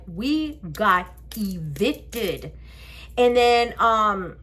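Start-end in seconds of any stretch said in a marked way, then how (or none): 0.1-2.5 very slow, word by word; deliberate, separated syllables; emphatic insistence. Retold or imitated. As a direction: spell it out so he believes you. Insists she is not playing.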